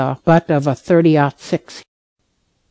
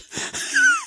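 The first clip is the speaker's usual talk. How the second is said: short laugh; 5 dB quieter than usual. Real, not acted. Laugh after telling story.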